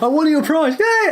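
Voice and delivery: high-pitched, giddy winner's cheer; mock triumph. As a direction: celebrate with a jump up into a high "yay". Acting out a triumphant winner's return.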